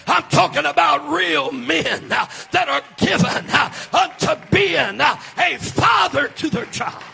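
loud, sing-song preaching with an uh after each phrase; full-force preaching. Acted. Preacher to his congregation, shouting sermon. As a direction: build the fire, shouting to the church.

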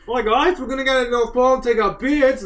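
high-pitched mock Australian; silly Australian voice for a group of guys; Australian accent. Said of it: "He's doing Australian now".

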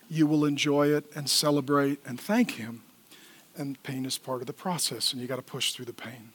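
low, very soft and heartfelt; hushed, sincere thanks with long pauses; tender pastoral address. Real, not acted. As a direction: speak gently to people you love.

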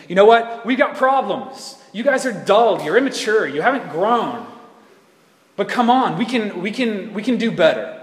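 rally them like a coach at halftime; rousing exhortation. Acted. Author of Hebrews urging a dull church on, rousing.

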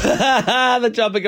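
high, drawn-out words through laughter; laughing. Real, not acted. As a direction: crack up while repeating the phrase. Cracks up at co-host's description.

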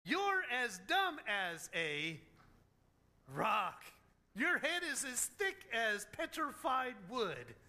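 slow, drawn-out exasperated put-down; deliberate, emphatic teasing insult, with a pause before the punch; blunt mock insult. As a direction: spell it out slowly for someone dense. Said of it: "as if Jesus is saying".

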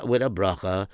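usual speaking voice

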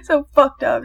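high-pitched words through laughter; laughing. Real, not acted. Speaker tells friend it's messed up, laughing. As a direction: talk while cracking up. Laughing over ruined walruses.

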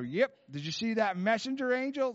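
high-pitched Southern twang; Bob Jones. Retold or imitated. Prophet answering the caller's phone call, high Southern accent. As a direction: play an excited Southern country prophet. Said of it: "giving you a little Bob Jones accent".

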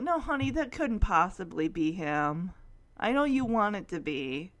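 low, soothing mother's voice; gentle letdown. Retold or imitated. Laura tells Jamie it can't be Chance, gentle and low. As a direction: let him down softly.

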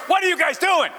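high, urgent mock yell; frantic. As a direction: demand answers as a frantic father. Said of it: "now he's frantic".

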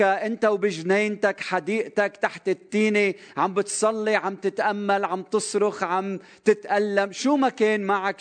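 high, ringing preacher's voice of Jesus; Jesus speaking, fervent. Acted. Jesus tells Nathanael he saw him, fervent and high. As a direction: proclaim it as Jesus who saw him all along.

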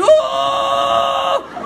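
hammed-up groan on the toilet; retold groan of relief. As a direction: let out a big high groan. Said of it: "you're just like".

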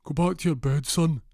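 high, loud fake father; put-on dad voice. Burglar pretending to be the boy's dad, high put-on voice. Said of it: "Jeff puts on a voice".

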